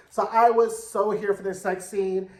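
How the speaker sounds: normal talking voice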